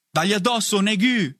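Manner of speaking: high, urging cheer; exclaimed encouragement. A narrator's reading. Bonnet urging Negu on in the fight, high exclamation. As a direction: egg your fighter on. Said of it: "esclamò Bonnet".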